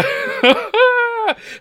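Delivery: high, loud laugh; burst of laughter. Real, not acted. Host laughing at his own burn-it-down attitude, loud laugh. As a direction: crack up at his own line.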